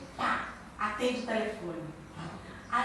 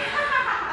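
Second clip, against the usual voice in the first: imitated husband's laugh; 12 semitones higher, 4 dB louder than usual. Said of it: "Aí ele".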